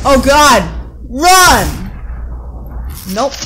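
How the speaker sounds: loud, high yell to flee; alarmed, full-volume outburst; panicked shout. Pretend. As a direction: scream it as if escaping danger. Game warns system cracked, player flees.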